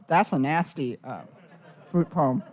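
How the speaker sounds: normal talking voice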